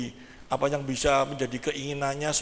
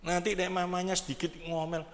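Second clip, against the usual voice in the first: normal talking voice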